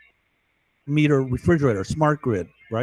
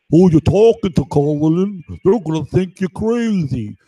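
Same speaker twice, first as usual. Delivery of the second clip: mocking heckler. Watcher taunts man over a microphone, mocking heckler. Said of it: "I'm talking on this microphone. And he goes".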